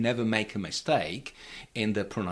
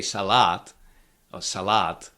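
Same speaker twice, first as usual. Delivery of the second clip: "salad" with a weak first vowel and stressed LAD; misplaced stress; 3 semitones lower than usual. Shown as a demonstration. Teacher showing what you cannot say, end-stressed "salad". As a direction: lean on the final long A of "salad". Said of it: "you cannot say salad".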